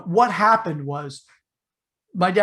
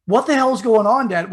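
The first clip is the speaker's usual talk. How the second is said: higher and louder, startled; mock alarmed yell; 6 semitones higher, 9 dB louder than usual. Six-year-old startled awake by a gun, yelling. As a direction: blurt it out like a shocked kid. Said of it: "I didn't say".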